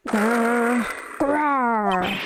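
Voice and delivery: two drawn-out put-on tiger growls; growl, then roar like a tiger. Tiger coming this way, growling. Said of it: "I do a great impersonation of a tiger".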